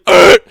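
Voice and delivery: sudden loud animal bark; imitated sea lion bark. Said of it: "rears its head and fucking barks".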